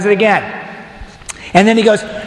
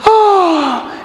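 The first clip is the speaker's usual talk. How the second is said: mock gasp; 9 semitones higher than usual. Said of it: "the women do what women do".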